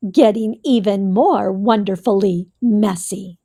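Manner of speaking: ordinary delivery, nothing marked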